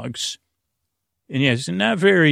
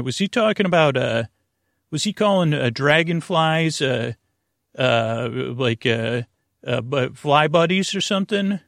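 ordinary talk, nothing marked

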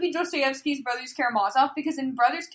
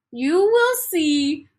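slow, louder ominous line; hammed-up mysterious teacher; slow, mock-spooky fanfic teacher; mock ominous reading; 4 semitones higher, 6 dB louder, much slower than usual. Reading fanfic dialogue aloud.

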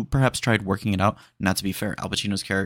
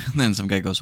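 ordinary delivery

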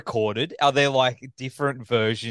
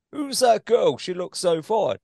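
pitched-up mock English accent; imitating an English accent; 7 semitones higher than usual. Host mimicking the English guest vocal, higher English accent. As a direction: put on a higher English voice for the line. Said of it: "the English dude going".

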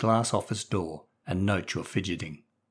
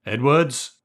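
summoning call; 4 semitones higher, 6 dB louder than usual. Acted. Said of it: "The headmaster eventually summoned him".

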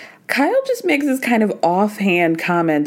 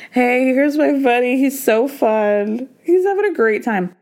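goofy buddy voice; 3 semitones higher than usual. Retold or imitated. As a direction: present your buddy proudly. Kyle showing off banana.

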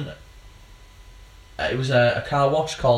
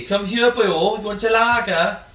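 higher, hammed-up Welsh accent; a bad sing-song Welsh impression, slowing at the end; 8 semitones higher, 3 dB louder than usual. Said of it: "Can't really do the Welsh accent".

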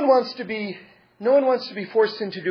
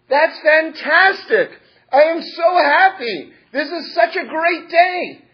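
much higher, louder, slow overjoyed exclaim; delighted rabbi; 9 semitones higher, 6 dB louder, slower than usual. Acted. Rabbi answers the militant atheist student, overjoyed. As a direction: savour every word with joy.

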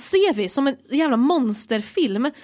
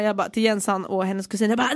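normal talking voice

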